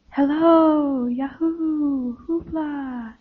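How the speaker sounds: soft echo fading away. A narrator's reading. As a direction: repeat the calls like a distant, dying echo. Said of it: "it repeats back to them".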